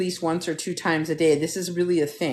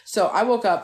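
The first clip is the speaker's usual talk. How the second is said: normal talking voice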